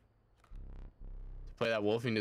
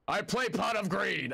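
Yugi impression shouted; 10 semitones higher than usual. Streamer imitating Yugi shouting his card, high and proud. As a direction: announce it like an anime hero. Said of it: "I don't know how to do his voice".

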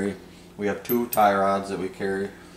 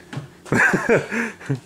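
giggle through hiccups; 14 semitones higher, 4 dB louder than usual. Real, not acted. Laughing at own hiccups.

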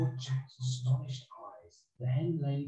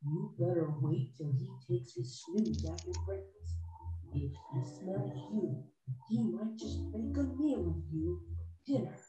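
softer, higher conspiratorial warning; ogre's wife, cautioning; 6 semitones higher, 7 dB quieter than usual. Acted. Ogre's wife tells hiding Jack to wait, cautioning. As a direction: warn the boy in a soft, raised woman's voice.

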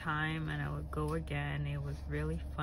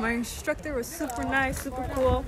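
high, loud post-jump thrill; buzzing right after landing; excited; 9 semitones higher, 11 dB louder than usual. Real, not acted. Just landed after skydive.

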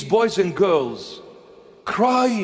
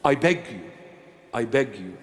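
earnest plea; 11 semitones lower, 4 dB quieter, faster than usual. Real, not acted. Pleading to the congregation.

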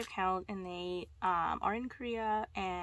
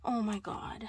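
ordinary talk

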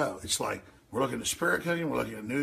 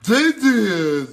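slow, loud, higher sarcastic echo; mocking repeat; 5 semitones higher, 8 dB louder, much slower than usual. Host sneering back at the guest's bot farm claim, slow and loud. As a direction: drag it out like it's absurd.

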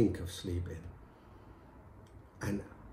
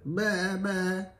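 bleated bah, higher; goat bleat; 8 semitones higher than usual. Acted. Wife practising her goat answer, bleating. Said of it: "And she said".

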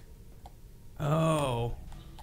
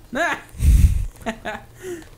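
loud laugh; 8 dB louder than usual. Real, not acted. Big laugh at game.

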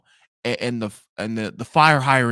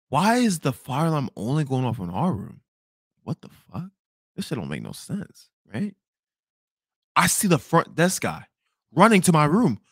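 ordinary talk, nothing marked

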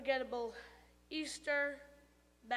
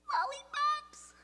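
much higher, louder squeaky tooth voice; tooth calling out; 14 semitones higher, 4 dB louder than usual. Acted. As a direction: blurt it from inside the mouth.

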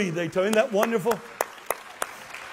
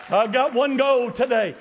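ordinary delivery

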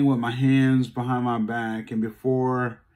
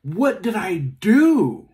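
loud, high, slow desperate yell; slow frightened yell; 7 semitones higher, 6 dB louder, much slower than usual. Retold or imitated. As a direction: shout it out in fear at the police. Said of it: "And I yell".

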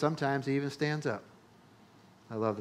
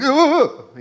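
much higher, louder yelps imitating a flinching son; mock flinching kid; 12 semitones higher, 12 dB louder than usual. Son Aiden flinching in the car, mock yelps. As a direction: play the son dodging a pretend punch. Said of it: "He's like".

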